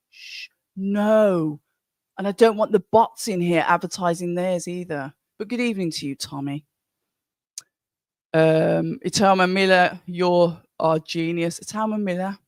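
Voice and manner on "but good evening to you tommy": normal talking voice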